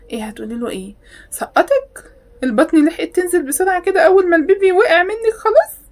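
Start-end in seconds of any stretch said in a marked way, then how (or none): 1.3-5.8 high, loud sarcastic outburst; mocking disbelief. Real, not acted. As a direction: scoff at the fake story. (سخرية وعدم تصديق)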